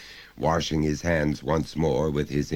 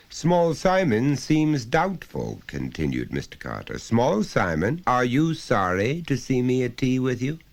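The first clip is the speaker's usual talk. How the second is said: stern father; 7 semitones higher than usual. Acted. Dentist father needling his small son at tea, mock-jovial. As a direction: needle the boy with forced cheer.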